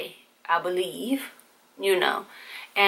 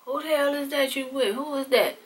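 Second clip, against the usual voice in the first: higher, suspicious dad voice; nosy dad; 6 semitones higher, 4 dB quieter than usual. Retold or imitated. Dad demanding to know who the girl is, suspicious. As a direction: squint and demand it.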